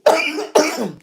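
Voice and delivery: a sudden harsh cough; a chesty cough mid-livestream; real cough. Real, not acted. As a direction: cough hard, then pause. Sick host coughing on live.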